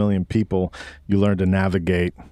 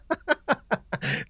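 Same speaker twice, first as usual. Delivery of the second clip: soft laugh; 9 semitones higher, 7 dB quieter than usual. Real, not acted. Embarrassed by band name.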